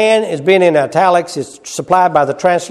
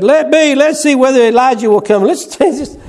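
high, loud taunting jeers; mocking crowd; 9 semitones higher, 4 dB louder than usual. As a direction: taunt like a jeering bystander.

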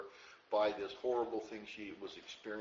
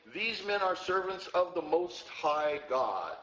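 loud, high, drawn-out proclamation; crying out; 6 semitones higher, 5 dB louder, slower than usual. Retold or imitated. Preacher quoting the girl who cried out after Paul, loud proclamation. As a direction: call it out slowly, raised and ringing. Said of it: "and cried out".

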